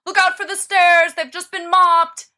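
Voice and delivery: high, loud taunting call down the hall; howling after him. A narrator's reading. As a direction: shout after someone running away. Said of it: "howls after his retreating footsteps".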